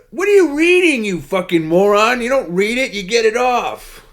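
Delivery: high, loud furious yell, slowing at the end; mock angry yell. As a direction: yell insults like an exasperated host. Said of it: "I'm paraphrasing".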